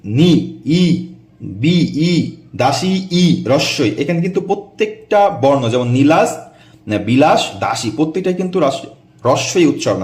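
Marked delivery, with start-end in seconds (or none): 0.1-3.9 each vowel ই stretched long and far apart; drawn out vowels. Shown as a demonstration. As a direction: pull out every ই sound slowly. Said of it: "এই যে". (প্রতিটি ই টেনে টেনে উচ্চারণ)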